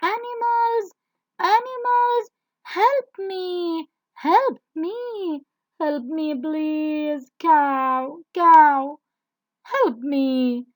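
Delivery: high, loud cries for help; a fallen horse calling out, high and loud. Acted. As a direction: call out to the animals desperately. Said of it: "started calling animals to help him".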